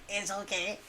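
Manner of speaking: very quiet, low character imitation; Inosuke. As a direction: murmur it low, in a mock anime voice. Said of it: "Like Inosuke in Demon Slayer is like".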